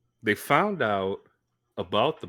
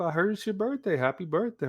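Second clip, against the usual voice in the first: higher, sugary boss voice; sweet manager voice; 10 semitones higher, faster than usual. Retold or imitated. Manager calling on his birthday, higher and sweet. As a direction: play the boss all friendly on the phone. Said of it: "I'm like".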